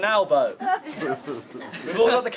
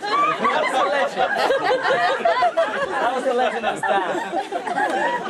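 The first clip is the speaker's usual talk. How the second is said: mock grand pronouncement; slower than usual. Mock-grand line in wedding speech.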